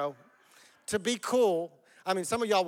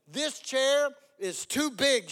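slow, higher storybook exclamation; exclaiming; 6 semitones higher, slower than usual. A narrator's reading. Goldilocks trying the first chair, exclaiming. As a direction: exclaim it word by word, drawn out. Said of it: "she exclaimed".

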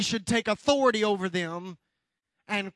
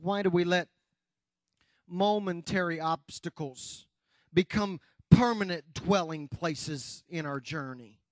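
usual speaking voice

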